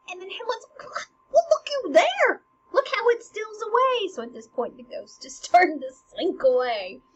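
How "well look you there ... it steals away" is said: high, loud urgent outcry; frantic Hamlet. Acted. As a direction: urgently point out the departing ghost. Hamlet points at departing ghost.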